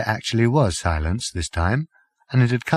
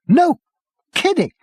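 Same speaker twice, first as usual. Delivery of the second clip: high, loud yelp; 5 dB louder than usual. A narrator's reading. Prack meeting Arthur, a high yelp. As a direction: jump out of your skin with glee. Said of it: "he yelped".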